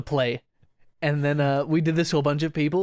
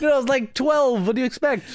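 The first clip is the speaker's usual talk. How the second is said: defensive shout; 8 semitones higher than usual. Real, not acted. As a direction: shout your excuse over the teasing. Defending himself, laughing.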